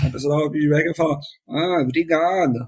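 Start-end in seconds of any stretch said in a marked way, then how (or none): 1.5-2.6 mock thanks through gritted teeth; slow, reluctant "obrigado", imitated; grudging thanks. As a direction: squeeze out a forced thank-you. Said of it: "o obrigado saía meio entre os dentes".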